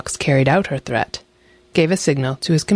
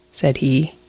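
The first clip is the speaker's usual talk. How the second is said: ordinary delivery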